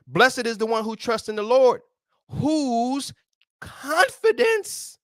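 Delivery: excited scripture reading. Real, not acted. Preacher thrilled by the verse, soaring high. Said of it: "oh my goodness laughs".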